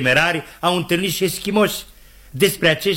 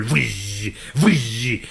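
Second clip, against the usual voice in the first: drawn-out wind noise; howling wind; 4 semitones lower than usual. Acted. Storyteller gives the wind's reply to Scott, long whooshes. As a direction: imitate gusts whooshing by. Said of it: "Și vântul".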